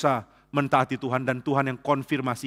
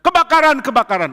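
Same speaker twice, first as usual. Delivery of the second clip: a panicked high shout of fire; 10 semitones higher, 8 dB louder than usual. Retold or imitated. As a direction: raise the alarm, very high and loud. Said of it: "anak-anak muda teriak". (teriakan panik "Kebakaran")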